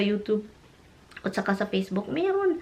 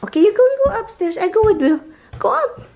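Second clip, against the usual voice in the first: much higher, quick, cheerful coaxing; coaxing dog; 10 semitones higher, 4 dB louder, faster than usual. Real, not acted. Owner urging the dog upstairs, in high cheerful coaxing. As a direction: lift your voice way up to urge the dog on.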